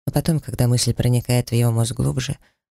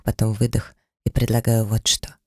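plain reading voice

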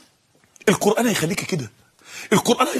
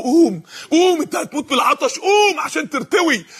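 raised-pitch acted exhortation; urgent urging; 4 semitones higher than usual. Urging a man dying of thirst, insistent. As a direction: push him to get up.

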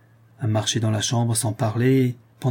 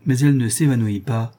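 usual speaking voice

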